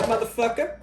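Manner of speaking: high, loud rising "motherfucker?"; mock challenge. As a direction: throw "motherfucker?" out as a playful dare, high and loud. Defiant challenge to viewers.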